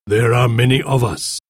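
measured, stately chief's voice; solemn chief. A narrator's reading. Golden Eagle meets Anthea under the flag, solemn. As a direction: announce yourself as a proud chief.

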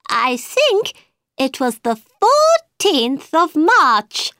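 high, slow hatter; high nervous hatter; Mad Hatter voice. A narrator's reading.

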